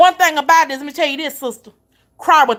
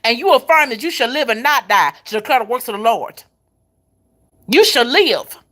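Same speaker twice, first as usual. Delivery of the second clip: fervent sermon declaration; preaching. Acted. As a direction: proclaim it like a preacher to the church. Preaching to the congregation.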